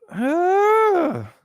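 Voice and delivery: long, high held squeal; high-pitched tantrum cry; mock angry squeal. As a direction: let out a sustained high whine of pretend anger. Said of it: "pay attention to his anger".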